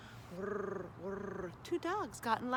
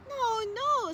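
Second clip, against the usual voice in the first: put-on child voice; 13 semitones higher, 7 dB louder than usual. Boy corrects robot.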